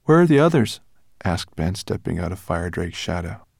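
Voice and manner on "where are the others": calling out. A narrator's reading. Ben calls out.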